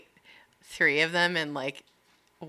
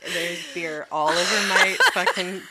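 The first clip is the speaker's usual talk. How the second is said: normal talking voice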